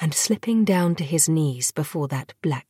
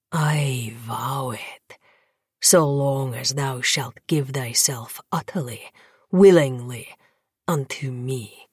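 low seductive vampire; 4 semitones lower than usual. Acted.

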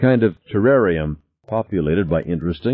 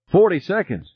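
much higher, tense voice; panicky countdown; 12 semitones higher than usual. A narrator's reading. Passenger counts down to the explosion, high and panicky. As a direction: call the countdown anxiously.

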